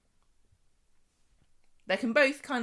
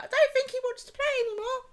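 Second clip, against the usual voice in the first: put-on much higher, fast child's voice; high boyish Jack; 5 dB louder, faster than usual. Jack pleads with Abby to stop the game. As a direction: squeak it like a timid boy. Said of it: "Jack's like".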